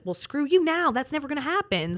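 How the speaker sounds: high, loud acted retort; defiant retort. Teen told to calm down by helplines, high defiant mock outburst.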